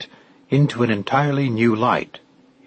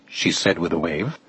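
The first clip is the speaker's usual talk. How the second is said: ordinary delivery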